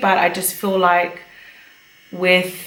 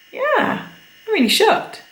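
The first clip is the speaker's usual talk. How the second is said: mock shock; 12 semitones higher than usual. Mock shock at friend's size.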